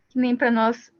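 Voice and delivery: ordinary talk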